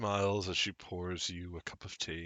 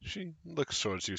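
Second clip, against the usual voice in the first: ordinary delivery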